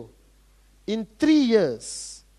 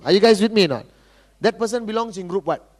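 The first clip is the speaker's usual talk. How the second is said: ordinary talk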